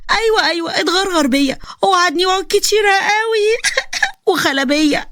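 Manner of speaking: slow, high mock sobbing; fake crying. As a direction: wail the complaint like a hammed-up crybaby. Zeina fakes crying to mother.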